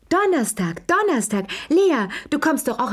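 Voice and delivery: high, eager calling voice; 1 eager old lady. A narrator's reading. Madame Pelou calling out her invitation, high and eager. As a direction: sing it out like a chatty old madame.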